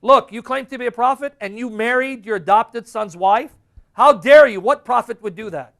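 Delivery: loud, high, indignant challenge; mocking crowd. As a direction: act out outraged people taking him to task. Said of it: "people kept mocking him".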